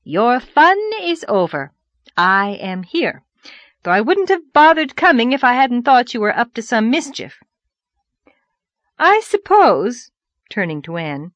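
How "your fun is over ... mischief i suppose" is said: loud, higher, scolding declaration; triumphant wife. Acted. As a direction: warn him the game is up. Wife addresses absent husband.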